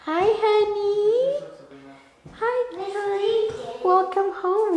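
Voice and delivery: high, slow sing-song greeting; welcoming someone home. Real, not acted. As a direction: greet them sweetly, drawn out. Greeting family arriving home.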